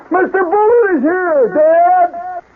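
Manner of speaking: put-on high, drawn-out kid calling up to Dad; kid's voice. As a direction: yell it upstairs in a piercing falsetto child voice. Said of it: "It's my kid's voice".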